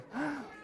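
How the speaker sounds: quick high startled gasp; pretend gasp. Librarian fakes fright at the children's boo with a gasp. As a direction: act scared by the boo.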